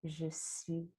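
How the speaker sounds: très doux, presque murmuré; souffle-le à l'inspiration; mantra soufflé. Shown as a demonstration. (murmure doux sur l'inspire)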